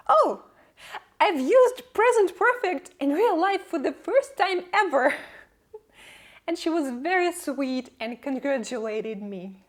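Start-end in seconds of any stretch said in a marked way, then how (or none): 0.1-5.1 high, loud burst of delight; excited exclamation. Acted. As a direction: gasp "oh" and gush in amazed triumph. Acting out delight at grammar milestone.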